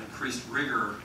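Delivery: ordinary talk, nothing marked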